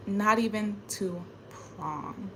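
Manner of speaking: "Not even to prom." langsam und leise, verbittert; bitter quiet. Real, not acted. Slow soft bitter repeat.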